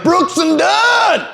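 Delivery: slow, high drawn-out yell; long, high, laughing whoop; country shout. Retold or imitated. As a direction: holler it like a country fan. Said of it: "You're like".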